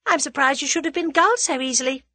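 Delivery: light, fast disdain; scornful. A narrator's reading. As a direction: taunt a dupe scornfully, briskly. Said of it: "said Tuppence scornfully".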